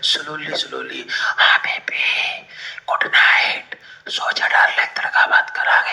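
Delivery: soft whisper, slow and tender; whispering softly. Shown as a demonstration. Teacher demonstrates slow quiet speech to students as a whisper. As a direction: hush it like soothing a baby. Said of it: "भाई कैसे बोलने लग रहा है".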